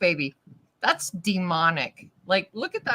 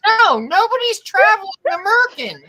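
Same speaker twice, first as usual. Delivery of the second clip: higher, louder outburst of refusal; loud protest; 10 semitones higher, 6 dB louder than usual. Real, not acted. Co-host protesting the merkin joke, loudly.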